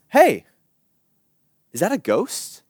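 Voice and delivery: high, loud "hey", then puzzled question; startled call. Acted. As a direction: call out at a strange sight. Startled fisherman calls out in storm.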